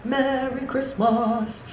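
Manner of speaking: high, soft, very slow holiday greeting; exaggerated, drawn-out festive greeting; drawn-out mock greeting. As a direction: stretch each syllable out in a soft singsong. Mock greeting on a bad morning.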